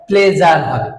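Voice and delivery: usual speaking voice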